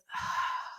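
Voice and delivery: long, breathy huff; exasperated sigh. Acted. Becky about to tell off Mister Edlund, exasperated sigh. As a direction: heave a sigh before objecting.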